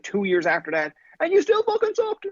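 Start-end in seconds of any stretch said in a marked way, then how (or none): 1.2-2.3 high mocking voice. Retold insult from others.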